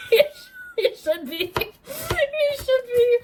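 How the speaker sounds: high, cracking laugh-cry; laughing through tears. Real, not acted. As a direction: squeal it through tearful laughter. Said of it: "I'm crying my makeup off".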